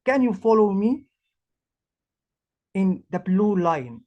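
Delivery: ordinary delivery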